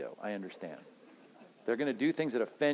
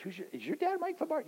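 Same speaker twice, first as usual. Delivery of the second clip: much higher, softer surprised question; 5 dB quieter than usual. Acted. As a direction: jump up in disbelief. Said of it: "voiced customer in line".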